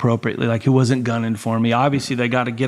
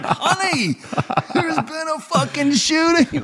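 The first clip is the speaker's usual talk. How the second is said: high, strained mock pleading; exasperated protest; slower than usual. Husband defending himself to angry wife, mock-exasperated. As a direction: protest in comic disbelief, voice pitched way up.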